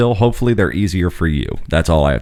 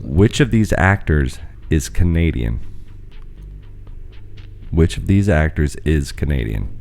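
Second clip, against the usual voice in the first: slow, drawn-out quiz host read; game show host; 4 semitones lower, 5 dB quieter than usual. Acted. Quiz host reads question.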